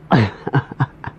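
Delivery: short run of chuckles; amused laugh. Real, not acted. Storyteller amused at being found out, chuckling laugh. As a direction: laugh in little bursts. Laughs retelling pastor's remark.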